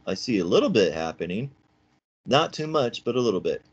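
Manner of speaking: sing-song experiment talk, lifted in pitch; soft-talk demo. Shown as a demonstration. Teacher talking to students over a salt drum, demonstrating gentle talk. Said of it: "that was just talking softly".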